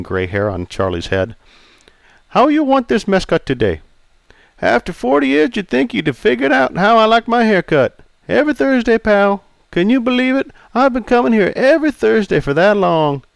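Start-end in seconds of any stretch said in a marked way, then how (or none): 2.3-3.8 put-on raised, accented old barber voice; polite Chinese-accented barber greeting. Pei asks. 4.6-13.2 put-on raised, jovial old customer. Charlie teasing.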